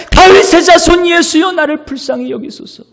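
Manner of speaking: very high, loud unbroken plea; insistent crying out. Acted. Blind beggar calling on the Lord over the scolding, shouting. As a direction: keep calling, straining to be heard. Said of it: "계속 주님의 이름을 부르는 것이에요".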